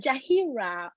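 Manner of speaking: Jahira, the middle rhymed with "see"; mispronounced name. Retold or imitated. Woman quoting how others get her name wrong, mispronounced. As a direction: say Jahira with a long "hee" in the middle. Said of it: "not said properly".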